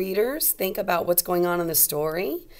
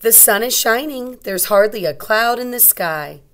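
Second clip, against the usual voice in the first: louder, bright storybook voice; reading to children; 3 semitones higher, 7 dB louder than usual. A narrator's reading. Teacher reading a picture book aloud to young kids.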